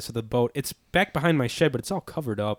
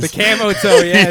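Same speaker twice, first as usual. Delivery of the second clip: ordinary talk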